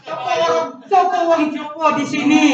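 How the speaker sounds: ordinary talk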